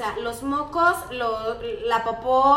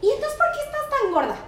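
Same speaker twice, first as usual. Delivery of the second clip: put-on much higher kid's voice, louder mid-line with a laugh; blunt child; 10 semitones higher, 6 dB louder than usual. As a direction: fire it off with a child's naive bluntness. Boy's blunt question.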